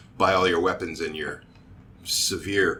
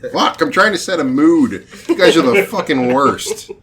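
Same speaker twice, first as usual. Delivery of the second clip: loud, high-pitched scolding; exasperated outburst; 8 semitones higher, 11 dB louder than usual. Real, not acted. Game master trying to set a mood, exasperated shout. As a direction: blow up at the joking players.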